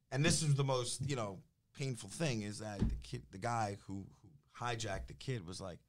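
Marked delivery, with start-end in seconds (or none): none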